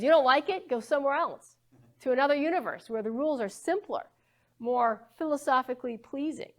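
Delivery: lightly imitating a Brooklyn physicist accent; Feynman Brooklyn accent. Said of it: "imagine this in his Brooklyn accent".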